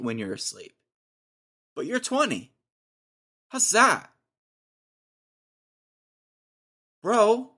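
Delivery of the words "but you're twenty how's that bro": squeaky high, louder incredulous whine; high-pitched ribbing of a twenty-year-old; mock disbelief. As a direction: jump way up in pitch in fake outrage. Teasing the snoring roommate.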